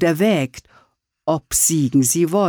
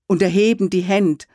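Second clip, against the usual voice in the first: usual speaking voice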